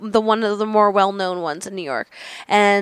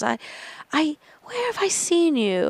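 put-on slight European accent; 5 semitones higher, 6 dB quieter than usual. Woman on a train trying to place the comic, European accent. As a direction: imitate a European woman searching her memory. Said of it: "She had a little accent".

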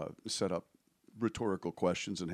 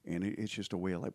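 ordinary delivery

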